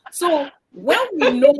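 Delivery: breaking into loud laughter; laughing. Real, not acted. Host resuming after a joke about whispering, laughing loudly. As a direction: try to carry on, then crack up.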